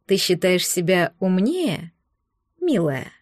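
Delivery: low, slow, oily sweetness; unctuous. A narrator's reading. Fiancé taunting the girl who defied him, in an unctuous voice. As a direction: purr it low with false sweetness. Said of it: "произнёс Рэмбо елейным голосом".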